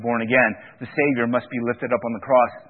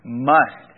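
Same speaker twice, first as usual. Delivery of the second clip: "Must" hit hard and louder, falling; emphatic must; 5 dB louder than usual. Shown as a demonstration. Preacher stressing the imperative to his congregation, emphatic "Must". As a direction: punch the single word "Must" as a command. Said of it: "put that imperative in there".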